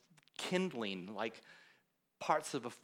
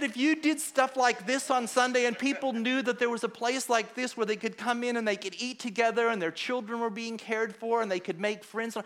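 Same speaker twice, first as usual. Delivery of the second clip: high, loud, excited pitch of an idea; eager rush of enthusiasm, voice raised high; retold enthusiasm; 11 semitones higher, 7 dB louder than usual. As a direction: reenact someone thrilled by a new idea.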